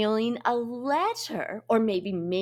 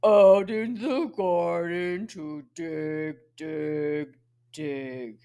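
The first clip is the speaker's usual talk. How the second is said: low pig voice; 6 semitones lower, much slower than usual. Acted. Big pig answering the children's question, low voice. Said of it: "he uses his low voice".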